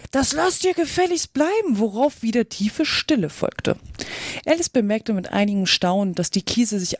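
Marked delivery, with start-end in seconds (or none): 0.1-1.8 high-pitched cry of protest; indignant shout. A narrator's reading. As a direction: call out a stern order, pitch well up. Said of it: "und schrie hinaus". (empört hinausgerufen, hohe Stimme)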